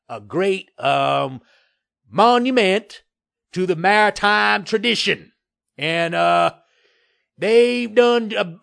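loud, halting, blustering compliments; forced praise. A narrator's reading. Developer faking praise for the camera, halting bluster.

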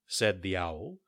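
plain reading voice

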